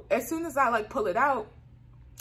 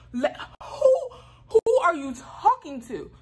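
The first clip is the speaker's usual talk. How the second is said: high, loud, drawn-out disbelief; offended and raised, mock confrontation; acted indignant retort; 10 semitones higher, 7 dB louder, slower than usual. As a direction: break off, then slowly demand it, affronted.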